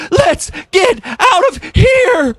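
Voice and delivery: high, slow, strangled gasp; hammed-up gasping escape, high and slow; choking. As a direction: choke the words out through the stink. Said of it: "raced for the window".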